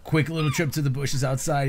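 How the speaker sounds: usual speaking voice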